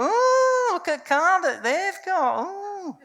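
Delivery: very high, slow nosy coo; hammed-up nosy gossip. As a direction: ooh it like a curious neighbour. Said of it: "We're nosy bastards".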